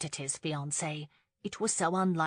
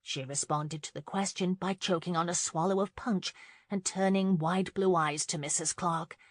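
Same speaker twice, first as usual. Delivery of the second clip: plain reading voice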